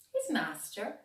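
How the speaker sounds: ordinary delivery, nothing marked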